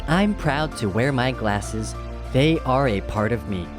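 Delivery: ordinary delivery, nothing marked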